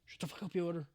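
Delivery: ordinary talk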